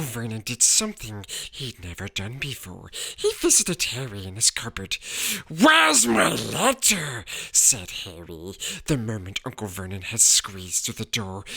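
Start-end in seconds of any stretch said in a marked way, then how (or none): 5.5-7.3 loud, slow shout; demanding shout. A narrator's reading. Boy shouting at his uncle for his letter. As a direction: demand it at once.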